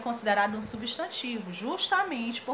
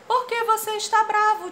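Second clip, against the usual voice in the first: put-on high, loud kid's question; a child asking her uncle; 11 semitones higher, 7 dB louder than usual. Child character asks uncle. (criança perguntando ao tio, voz aguda)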